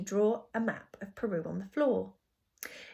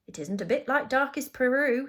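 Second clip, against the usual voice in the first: higher, louder announcement; announcing; 5 semitones higher, 7 dB louder than usual. A narrator's reading. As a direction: announce it brightly to the cab. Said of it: "he announced".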